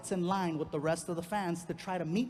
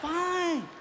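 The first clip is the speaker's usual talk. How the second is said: put-on huffy girlfriend; 11 semitones higher, 4 dB louder than usual. Girlfriend voiced, high.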